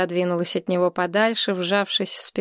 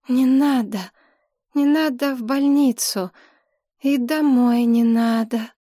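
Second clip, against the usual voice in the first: weak, struggling speech; 4 semitones higher, 4 dB louder than usual. A narrator's reading. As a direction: plead with effort, a little raised in pitch. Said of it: "с трудом шевеля пересохшим, непослушным языком". (слабая мольба через силу)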